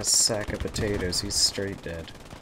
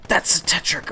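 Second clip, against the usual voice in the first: high loud startled call; shocked exclamation; 8 semitones higher, 6 dB louder than usual. Real, not acted. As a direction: blurt it in shock. Shocked after near miss.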